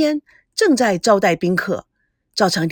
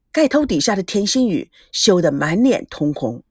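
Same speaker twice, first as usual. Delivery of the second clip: usual speaking voice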